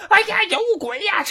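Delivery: 高声尖叫有鬼; 边跑边惊慌地喊. Acted. 追着人群惊叫. (高声惊慌喊有鬼)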